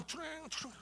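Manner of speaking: high, light crash noise; imitated crashing plates. Preacher mimics thrown glasses and plates, imitated clatter. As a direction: mimic flying crockery smashing, softly and high.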